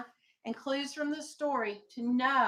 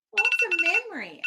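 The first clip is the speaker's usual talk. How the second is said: put-on child's eager question; 7 semitones higher, 7 dB louder, faster than usual. A narrator's reading. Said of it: "asked Wilford Gordon".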